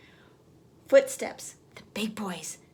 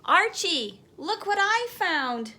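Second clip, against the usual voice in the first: excited call through a tube, higher pitch; shouting; 7 semitones higher, slower than usual. A narrator's reading. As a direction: call out to Archie, thrilled. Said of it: "Peter shouted through the tube".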